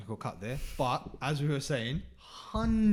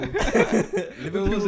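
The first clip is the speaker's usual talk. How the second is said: high, very slow mock Scouse; a laughing, stretched-out Scouse Liverpool; Scouse accent; 6 semitones higher, 8 dB louder, much slower than usual. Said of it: "Is he from Liverpool?".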